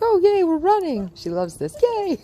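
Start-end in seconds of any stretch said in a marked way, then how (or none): 0.0-1.1 high, excited voicing of the dog's thoughts. Trainer voicing her dog's joy while running backwards, high and excited. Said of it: "And she's like". 1.8-2.2 high, loud squeal of praise; excited cheer. Real, not acted. Trainer cheering her setter for running along, high-pitched yay.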